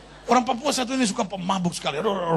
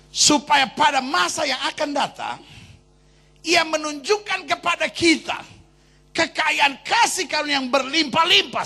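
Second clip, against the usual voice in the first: rising, loud sermon cadence; preaching at full force; 7 semitones higher, 4 dB louder than usual. Acted. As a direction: preach it to the crowd. Preaching to congregation.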